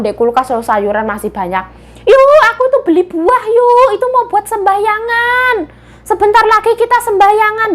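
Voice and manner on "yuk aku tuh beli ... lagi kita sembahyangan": mother's voice. Acted. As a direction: answer as the mum, high and bright. Mother voiced answering daughter.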